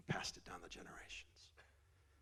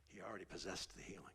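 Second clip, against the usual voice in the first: whisper; 3 semitones lower, 7 dB quieter than usual. Real, not acted. Hushed closing point.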